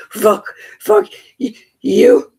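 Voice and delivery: loud, high, frantic cry; distraught yelling. Real, not acted. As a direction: yell it out in despair. Distraught outburst on son's arrest.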